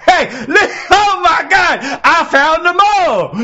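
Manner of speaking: high, loud shrieking laughter; laughing shout. Real, not acted. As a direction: crack up and yell it out. Cracking up at friend's face.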